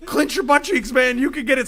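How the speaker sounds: high, loud mock coach cheer. Clowning on his friend, a mock high coach cheer. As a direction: hype him up like a fake coach. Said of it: "So now I'm clowning on him".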